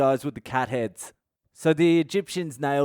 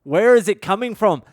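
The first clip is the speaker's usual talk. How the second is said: rising disbelief, getting louder to the last line; exasperated outburst; 5 semitones higher, 5 dB louder than usual. Retold or imitated. Retelling his toilet ordeal, a half-mock exasperated cry building louder. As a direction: throw up your hands at the mess.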